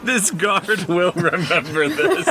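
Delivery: high, slow words through laughter; laughing echo. Real, not acted. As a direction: draw the line out while laughing. Echoing the game master, laughing.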